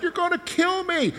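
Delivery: very high, mock-whining complaint; whiny Israelites. Preacher mimics Israelites whining at God, very high. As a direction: whine it like a spoiled child. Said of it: "they kept murmuring against God, like".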